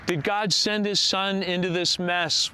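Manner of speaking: loud, ringing preacher's proclamation; full-force preaching. Acted. Pastor opening his Christmas sermon, loud full-force preaching. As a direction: proclaim it to the whole church.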